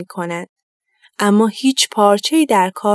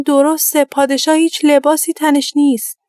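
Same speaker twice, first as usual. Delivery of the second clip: crowd exclaiming; 6 semitones higher than usual. A narrator's reading. Whole crowd agrees together.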